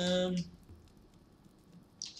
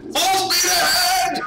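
long, high, drawn-out holler; very loud, high-pitched stretched shout; yelling; 9 dB louder than usual. Acted. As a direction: bellow it slowly like a battle cry. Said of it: "sorry for my yelling".

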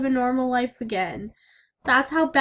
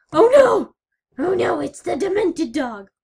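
mock panic; 5 semitones higher, 8 dB louder than usual. Panic as dog appears.

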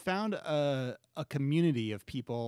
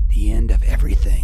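much louder, heavy and final; booming repeat; 4 semitones lower, 18 dB louder than usual. Acted. Corbin declares the end as the dome cracks, loud. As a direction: let the words ring out.

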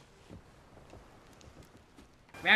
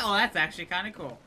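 ordinary talk

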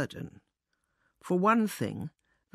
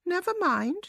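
mouse to himself; 10 semitones higher than usual. A narrator's reading. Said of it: "said Guru to himself".

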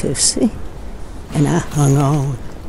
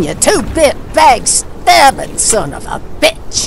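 furious insult; 10 semitones higher, 4 dB louder than usual. Acted. Cursing at the sky.

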